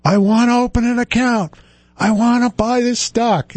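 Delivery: put-on high, slurred drunk ranting; drunken screaming. Drunk man yelling at the broker to open an account, mock drunken shout. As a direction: yell like a belligerent drunk demanding service. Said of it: "mad drunk, screaming".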